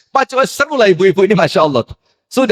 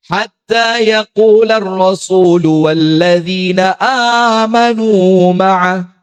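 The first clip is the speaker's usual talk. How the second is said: slow, drawn-out chanted recitation; Quran recitation; slower than usual. Acted. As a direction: recite the verse slowly in tartil. Quran verse recited in sermon. (lantunan tilawah yang lambat)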